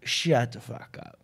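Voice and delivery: slow, low, soft command; no-punchline put-down. Retold or imitated. Comic tells heckler off from stage, slow low put-down. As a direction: drop the voice and space out each word. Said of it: "No punchline, no".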